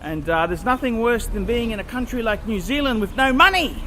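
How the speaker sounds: ordinary delivery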